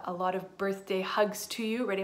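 ordinary delivery, nothing marked

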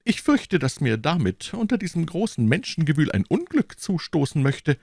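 high, fearful queen voice; trembling voice. Acted. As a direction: plead in a frightened, quavering voice. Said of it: "antwortete die Königin mit bebender Stimme".

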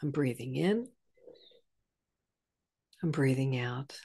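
soft, low, meditation murmur; guided breathing cue. Shown as a demonstration. Teacher showing how to return to the breath, softly and low. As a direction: ease back into the breath, gently and low. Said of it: "simply come back to".

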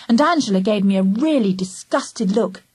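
ordinary delivery, nothing marked

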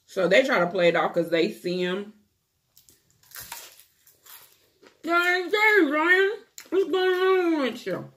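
talking while chewing food; speaking between bites of dumpling; mouth full, chewing. Real, not acted. Seen 3: Bites dumpling before; chews with mouth full while talking, holding piece.

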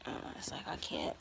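winded, barely voiced; short of breath, whispering; breathless. Real, not acted. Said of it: "catching my breath lately".

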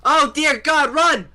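high, loud yell to run; urgent high-pitched holler; panicked warning shout. Real, not acted. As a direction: shout a frantic warning to teammates. Calling out a warning in game.

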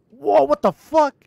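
loud, high, drawn-out disbelief; shocked exclamation. Real, not acted. Streamer startled mid-game, shocked exclamation. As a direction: cry out slowly in shock.

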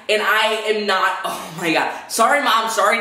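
normal talking voice

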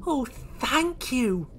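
high, slow, grateful little voice; Oisin. Acted. Oisin gratefully thanks Small, in a high voice. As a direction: stretch out the thanks, pitched high. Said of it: "said Oisin".

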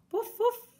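very high yappy woof woof; dog barking. Acted. Dog barking at the sausage stand, high yap. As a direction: bark like a little dog. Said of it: "a fait le chien".

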